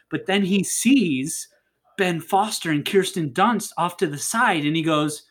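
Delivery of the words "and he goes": normal talking voice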